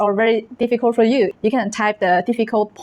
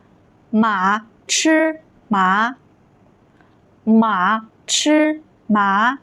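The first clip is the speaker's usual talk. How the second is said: each syllable long and separate; drawn-out Mandarin model reading; very slow reading; much slower than usual. Shown as a demonstration. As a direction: stretch out every tone for learners. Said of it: "I will read it very slow".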